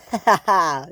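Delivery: acted gloating "ha ha ha"; mock laugh. Diesels gloating over Rosie, mock laugh. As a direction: laugh in separate, put-on beats.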